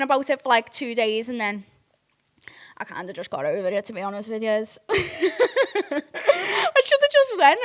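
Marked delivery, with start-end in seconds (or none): 4.9-7.6 laughing. Real, not acted. Laughing at herself.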